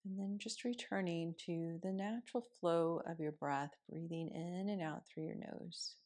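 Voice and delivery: soft, unhurried meditation voice with long pauses; guided meditation. Acted. Yoga teacher guiding students through morning seated meditation, softly.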